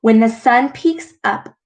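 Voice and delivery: gentle storyteller voice; read-aloud storytelling. A narrator's reading. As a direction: read it like a bedtime story to kids. Reads picture book aloud.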